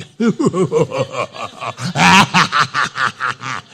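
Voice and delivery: hammed-up villain's cackle; gloating laugh. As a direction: cackle with exaggerated glee. Gloating as God takes enemy down.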